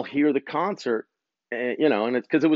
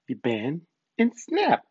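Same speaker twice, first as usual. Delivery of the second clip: slow, higher, playful catchphrase delivery; teasing film-line imitation with a high snap; movie quote; 4 semitones higher, slower than usual. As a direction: draw out bend, pause, then pop SNAP up high. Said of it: "Those of you who know the movie".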